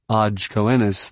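ordinary delivery

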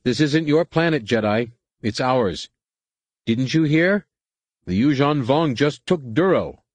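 lightly voiced angry mob leader; angry Aqualish spokesman. A narrator's reading. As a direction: taunt the Jedi with the news.

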